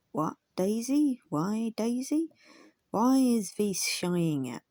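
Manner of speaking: slow, loud coaxing of a shying pony; old Quaker coaxing. Acted. Old Quaker driver coaxing his shying pony, slow and loud. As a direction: draw out the name like a gentle old driver.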